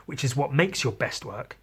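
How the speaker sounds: usual speaking voice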